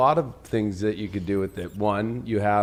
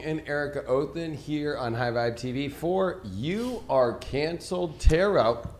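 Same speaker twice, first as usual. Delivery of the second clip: slowed-down hype announcer greeting; show host intro; 5 semitones higher than usual. Acted. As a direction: welcome the audience like a live TV host.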